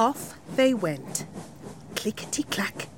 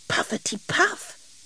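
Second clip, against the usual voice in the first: high breathy puffing train sound; be the little train puffing away; 9 semitones higher, 4 dB quieter than usual. Acted. The train answers the king, high puffing train voice. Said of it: "went Jane".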